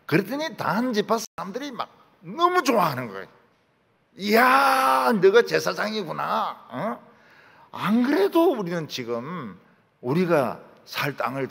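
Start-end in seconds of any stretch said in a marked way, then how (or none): 4.2-11.5 delighted cry. Acted. Danites overjoyed at finding a priest.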